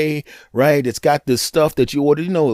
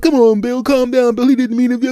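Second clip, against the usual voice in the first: higher, louder placating buddies; pleading friends; 9 semitones higher, 4 dB louder than usual. Retold or imitated. Friends try to calm drunk Bill, high placating plea. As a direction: coax him to calm down.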